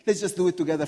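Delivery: ordinary talk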